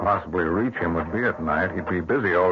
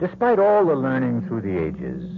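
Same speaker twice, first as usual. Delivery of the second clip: measured, ominous host introduction; old-time radio narrator setting the scene; radio mystery host; 2 semitones higher than usual. A narrator's reading. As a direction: draw the listener into the dark tale.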